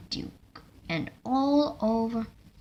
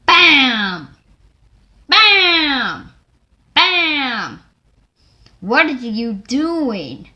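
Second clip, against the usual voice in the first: loud cry; 5 semitones higher, 14 dB louder, much slower than usual. Acted. Bumping, then Duke's outcry.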